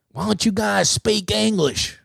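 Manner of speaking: high, slow Southern drawl; mock Southern girl. Southern girl passing two Arabic dudes, mock Southern drawl. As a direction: put on a drawn-out Southern girl voice. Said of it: "this Southern girl walks by him".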